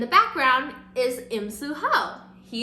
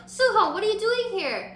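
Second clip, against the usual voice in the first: high-pitched acted surprise; imitated pleasant astonishment, much higher; surprised greeting; 10 semitones higher, 3 dB louder than usual. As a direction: greet an unexpected student, voice shooting up. Acts out teacher's surprised greeting.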